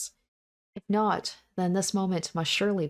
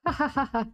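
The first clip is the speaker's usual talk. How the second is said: high, unhinged laughter; helpless laugh; 9 semitones higher than usual. Acted. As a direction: laugh despite the terror, can't stop. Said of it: "but I begin to laugh".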